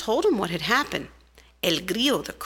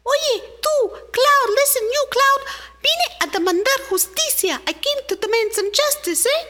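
very high, louder tiny ant voice, rushed complaint; indignant little ant; 12 semitones higher, 4 dB louder than usual. Acted. Little ant demanding justice from the rain, high and indignant. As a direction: squeak out an outraged demand.

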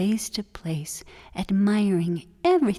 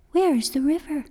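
worried; 7 semitones higher, faster than usual. Acted. Lost turtle wonders.

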